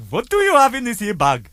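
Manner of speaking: put-on high, loud Indian-accented official. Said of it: "So the guy said".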